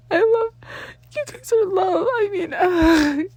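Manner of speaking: high, loud tearful sobbing words; crying. Acted. Danny crying out his love to his friends. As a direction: sob it out, overwhelmed. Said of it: "couldn't hold back the sudden need to cry".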